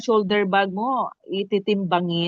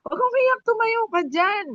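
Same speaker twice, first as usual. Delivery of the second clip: much higher pitch, pleading with her to stand up; very high coaxing voice; 13 semitones higher than usual. Shown as a demonstration. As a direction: pitch way up, like coaxing a crying woman off the floor. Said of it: "Yung ganun yung boses ko ba". (napakataas na boses, nakikiusap na tumayo siya)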